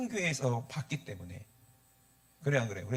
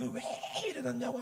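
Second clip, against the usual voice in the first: retold temper tantrum; 10 semitones higher, 4 dB quieter than usual. Son throwing a fit at father over the shrunken pulpit, mock angry. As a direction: retell a fit of temper in a raised pitch. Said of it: "막 화를 내고 승질 부리고".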